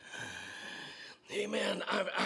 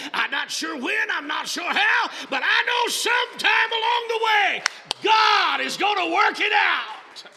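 rising to a loud, high shout; preaching at full force; 11 semitones higher, 11 dB louder than usual. Acted. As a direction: build it up to a roar. Preaching climax.

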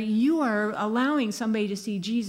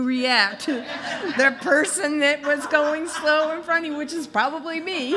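laughing; 7 semitones higher, 4 dB louder than usual. Real, not acted. Joking about slow driving.

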